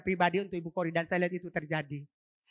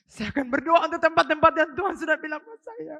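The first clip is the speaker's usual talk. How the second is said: high, loud declaration of resolve; loud preaching; 9 semitones higher, 11 dB louder than usual. Real, not acted. Preacher vowing to pray for the city, loud and high. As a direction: lift it up and proclaim.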